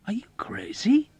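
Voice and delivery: high, whispery incredulous exclamation; disbelieving. A narrator's reading. Dobbs to his wounded friend, high breathy disbelief. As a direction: gasp it out in disbelief. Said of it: "Dobbs exclaimed in disbelief".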